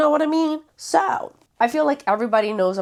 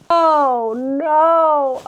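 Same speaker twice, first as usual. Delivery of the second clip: high, loud dismayed cry; sudden realisation it won't fit; 7 semitones higher, 8 dB louder than usual. Real, not acted. Placing the book on the shelf, a loud dismayed oh no.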